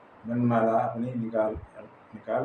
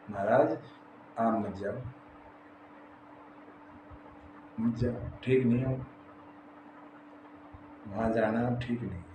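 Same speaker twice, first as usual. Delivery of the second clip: soft, halting dissuasion; gentle pleading; 3 semitones lower, 11 dB quieter than usual. Acted. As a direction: quietly talk him out of going. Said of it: "समझाया". (धीमे से समझाते हुए)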